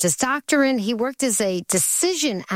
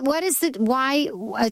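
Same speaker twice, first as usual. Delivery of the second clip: usual speaking voice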